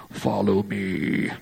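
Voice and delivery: low, slow, croaky put-on Jesus; drawn-out mock-solemn Jesus, deep and croaky. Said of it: "in your best Jesus voice".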